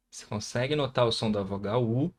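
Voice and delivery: ordinary talk, nothing marked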